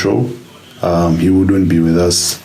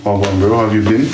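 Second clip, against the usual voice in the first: ordinary talk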